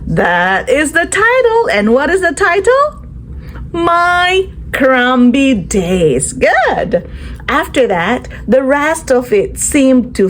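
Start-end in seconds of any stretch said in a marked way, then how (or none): none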